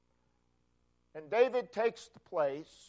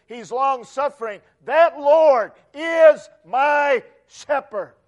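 loud, high sermon climax; preaching at full force; 4 semitones higher, 11 dB louder than usual. Real, not acted. Preacher extolling the Lord to his congregation, loud and rising. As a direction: build the praise to a ringing peak.

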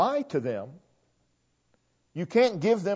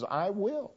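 "I will" set apart softly as the key words; quietly single out "I will"; stress on I WILL; 2 semitones higher, 9 dB quieter than usual. Shown as a demonstration. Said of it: "The focus is".

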